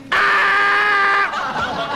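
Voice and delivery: sudden loud high cry; ant mating call shout. Pretend. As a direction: yell it out as a tiny insect call. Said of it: "That is the mating call of a worker ant".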